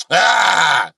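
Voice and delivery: loud, high acted scream; sudden full-voiced yell; mock scream. Comedian mimicking destructive women, loud mock scream.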